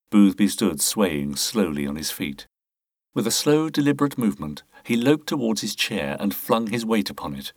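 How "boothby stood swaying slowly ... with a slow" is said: ordinary delivery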